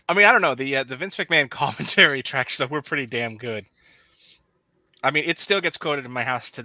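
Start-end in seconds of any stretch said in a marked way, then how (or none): none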